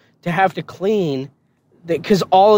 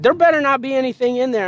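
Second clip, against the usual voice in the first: drill sergeant voice; 9 semitones higher, faster than usual. Retold or imitated. Drill sergeant warning, high fast.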